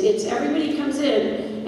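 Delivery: ordinary talk, nothing marked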